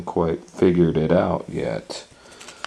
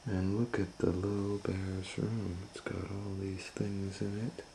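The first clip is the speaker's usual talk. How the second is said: hushed; 3 semitones higher, 10 dB quieter than usual. A narrator's reading. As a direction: lull them, softly, as she sleeps. Narration sinking into sleepy hush.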